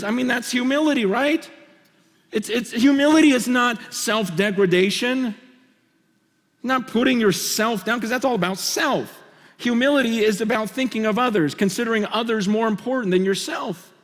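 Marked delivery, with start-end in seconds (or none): none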